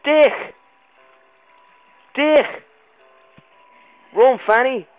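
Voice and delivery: loud high shouts of alarm; panicked yelling. Real, not acted. Player flees a cave monster, yelling in panic. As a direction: yell while fleeing.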